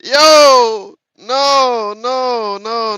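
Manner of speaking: loud, high cry of laughter; laughing protest. Real, not acted. Streamer laughing that Google misheard him, crying out. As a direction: howl no through laughter.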